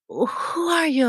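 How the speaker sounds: acted bewilderment; mild shock. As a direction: imitate a baffled teen, slowly. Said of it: "and she's shocked".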